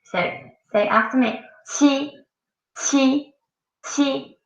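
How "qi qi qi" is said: qi, high and level, ch as in cheese; demonstrated qi. Shown as a demonstration. Teacher modelling qi for students, high and clear. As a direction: keep qi high and steady. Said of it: "like ch, like cheese".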